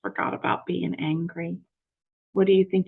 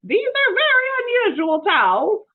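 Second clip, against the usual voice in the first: character voice; 9 dB louder than usual. Acted. Mister Rogers voiced.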